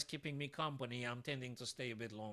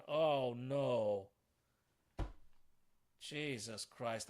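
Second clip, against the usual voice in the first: slow dismayed groan; 2 semitones lower, much slower than usual. Real, not acted. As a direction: stretch it out in despair. Groans over his mistake.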